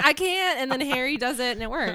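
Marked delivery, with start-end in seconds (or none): 0.0-0.6 high, loud panicked quote; mock panic. Quoting Hermione failing her spell, high mock panic. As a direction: blurt it high, imitating a panicking witch. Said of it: "she panics and can't do it".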